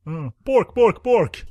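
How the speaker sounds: high, loud hammed-up borking; mock Swedish chef gibberish. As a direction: ham up fake Swedish porn talk. Mock Swedish porn dialogue.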